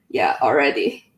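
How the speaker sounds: normal talking voice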